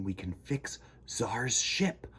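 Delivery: hushed whisper; conspiratorial whisper. Acted. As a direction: whisper it to the kids.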